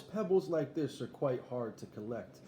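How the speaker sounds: usual speaking voice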